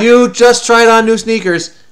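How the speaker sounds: loud, drawn-out playful accusation; teasing insistence. Real, not acted. Dad contradicting his child over new shoes, teasing insistence. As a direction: insist loudly, hitting every word.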